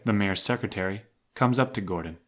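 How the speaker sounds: ordinary talk, nothing marked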